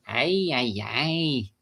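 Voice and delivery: mock Mexican accent exclamation. Shown as a demonstration. Trying out accents spontaneously, mock Mexican accent. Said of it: "We can do a Mexican".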